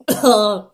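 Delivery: harsh cough from burning garlic; loud cough. Real, not acted. Eating raw garlic cloves.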